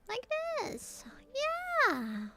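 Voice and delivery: high squeaky character voice; squeaky cartoon voice. Squeaky voice commenting sketch.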